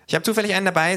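high, loud kangaroo voice; eager kangaroo. Acted. Kangaroo offering a sticker, high and loud. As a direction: pipe up helpfully in a high voice.

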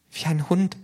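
high-pitched, alarmed character voice; startled. A narrator's reading. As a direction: say it in shock, high up. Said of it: "sagte das K erschreckt". (erschreckt, hohe Stimme)

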